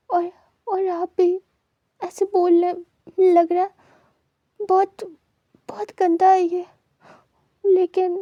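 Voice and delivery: put-on a boy sobbing out his hurt to his family; crying. A narrator's reading. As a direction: cry through the words, voice cracking high. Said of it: "वो wing को रोते हुए नहीं देख पा रहे थे".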